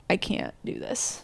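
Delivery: hushed, whispery quote of giving up; defeated mutter to oneself, retold. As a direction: drop to a slow near-whisper. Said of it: "I would leave and be like".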